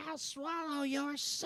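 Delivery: high, slow witch voice; slow high-pitched witch croak. Retold or imitated. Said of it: "the witch goes".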